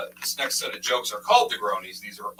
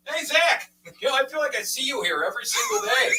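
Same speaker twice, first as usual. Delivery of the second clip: loud, higher chummy regular, laughing; a chatty liquor-store regular calling out; regular customer; 13 semitones higher, 4 dB louder than usual. Retold or imitated. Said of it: "they're like".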